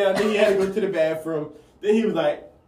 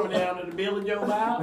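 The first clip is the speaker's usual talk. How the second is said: higher, softer mock-hillbilly voice; hillbilly accent; 4 semitones higher, 6 dB quieter than usual. Shown as a demonstration. Friend taking a hillbilly accent turn, hillbilly accent. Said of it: "the hillbilly accents".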